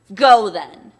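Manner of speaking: sudden loud dismissal; loud bullying order to his wife; barked command. Acted. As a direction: snap it out at full volume.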